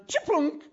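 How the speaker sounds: high, loud mock chiplunk sound; ball swish. The ball dropping through the hoop, sounded out high. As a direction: make the basket sound.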